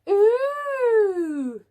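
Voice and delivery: a high, loud, squeaky chorus of wonder; little bees' ooh. Acted. Little bees marvelling at the new bee, high squeaky ooh. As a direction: coo like a crowd of tiny bees. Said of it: "said the little bees".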